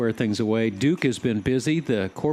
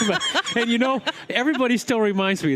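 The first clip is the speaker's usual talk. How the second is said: laughing; 10 semitones higher than usual. Real, not acted. Laughing after saying he was terrified.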